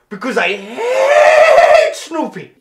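long screeched HATE, high and loud; hammed-up German-accented outburst; hateful scream. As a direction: scream it with HATE stretched right out. Kaiser vents his hatred.

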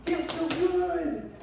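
high, slow drawn-out praise; squeaky praising a dog. Real, not acted.

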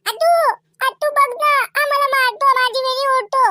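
put-on high-pitched child's complaint; tattling little girl. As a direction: tell on your brother to auntie. Child complains about brother.

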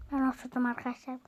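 usual speaking voice

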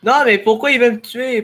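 high, loud fearful protest; scared outcry. Real, not acted. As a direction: cry out in fright. Zombie attacking him.

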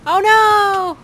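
loud, high, drawn-out "oh no"; dismayed cry. Real, not acted. Player falling in the game, loud dismayed cry.

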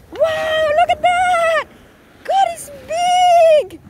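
high, loud cries of amazement; thrilled squeal at the big fish; excited. Real, not acted. Wife sees husband's big catch.

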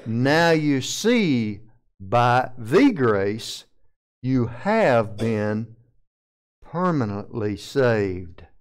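very slow, every word weighed, "permanently" stressed; slow, deliberate rendering. Shown as a demonstration. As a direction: spell out the better translation word by word. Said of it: "better phraseology would be".

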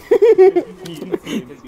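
loud high laugh; a big laugh bursting out; burst of laughter. Real, not acted. Laughing at casual players.

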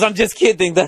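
high mocking cabbie with fake little laugh; mock passive-aggressive. Cabbie pretending he was joking, mock passive-aggressive. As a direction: take back the jab with a phony chuckle. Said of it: "Try to be passive-aggressive".

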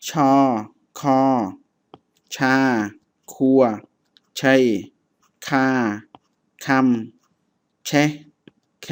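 อ่านช้า ๆ เว้นจังหวะ ชัด ๆ ทีละพยางค์; ออกเสียงตัวอย่างให้นักเรียนฟังตาม; อ่านพยางค์เขมรทีละคำ. Shown as a demonstration. Reads the Khmer syllable list aloud. (อ่านออกเสียงภาษาเขมรช้า ๆ ทีละคำ)

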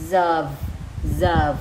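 "Zerv" as one joined syllable, starting on z; linked sound. Shown as a demonstration. Teacher drills the linking for moms, "Zerv" as one syllable. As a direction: say "Zerv" loudly and separately, z fused onto the vowel. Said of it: "phiên âm nối của nó nè".

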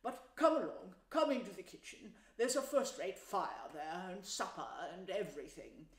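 fatherly, fussing Badger; kindly old-host character voice; paternal. A narrator's reading. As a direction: chide fondly, then usher them in. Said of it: "he said paternally".

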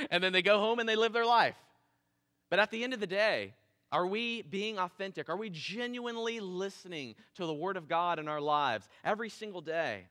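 ordinary talk

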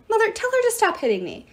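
high-pitched tattling plea; crying out. A narrator's reading. Child begging mother to intervene, high whining cry. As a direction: whine to mother about a sibling. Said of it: "cried someone".

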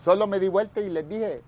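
normal talking voice

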